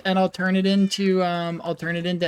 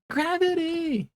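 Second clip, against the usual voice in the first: high, slow drawn-out call of a name; happy high call across the room; calling a friend; 9 semitones higher, 4 dB quieter, slower than usual. Real, not acted. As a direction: sing out the name to greet him.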